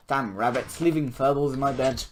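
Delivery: loud, indignant grumble; mock annoyance. Cursing rabbits for furballs in the bed, mock annoyance. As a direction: grumble loudly at the pesky pets.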